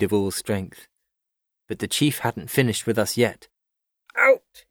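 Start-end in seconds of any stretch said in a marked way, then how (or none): 4.2-4.6 loud, high barked order; commanding cry. A narrator's reading. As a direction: shout it as a sudden order. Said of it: "he cried".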